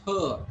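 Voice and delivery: "her" ending on an open long vowel; silent R. Shown as a demonstration. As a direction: drop the r in "her". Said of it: "R sound is not pronounced at all".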